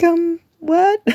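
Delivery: a sudden jump of disbelief; stunned disbelief. Retold or imitated. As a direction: caught completely off guard. Stunned by offer of car.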